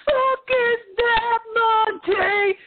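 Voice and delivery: very high, slow mock-furious yell; drawn-out, high-pitched retold outburst; Gordon Ramsay, furious. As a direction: imitate an enraged chef, stretching every word.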